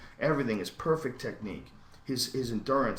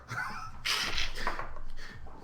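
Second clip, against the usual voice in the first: voiceless, hissing laugh. Real, not acted. Laughing at his own tattoo joke, breathy wheezing laugh.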